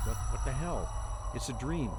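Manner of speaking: usual speaking voice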